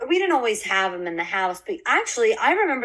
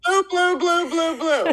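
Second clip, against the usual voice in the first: repeating loudly; 10 semitones higher, 4 dB louder, slower than usual. Real, not acted. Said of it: "Hold on, one more time".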